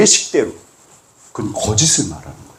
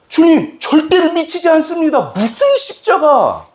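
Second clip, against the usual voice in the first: rebuking. Retold or imitated. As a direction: rebuke him, drawing out the last words. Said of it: "베드로가 그랬어요".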